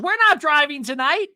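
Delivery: high, loud imitated housewife; mock shout. Podcaster mimicking a cast member, high and loud. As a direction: belt it out in a mocking impression.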